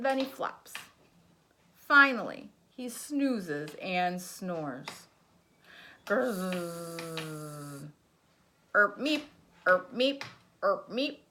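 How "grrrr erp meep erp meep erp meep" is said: plain reading voice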